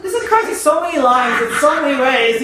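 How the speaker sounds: high, stretched-out squeal through laughter; hammed-up high-pitched gushing, laughing; laughing retold reaction.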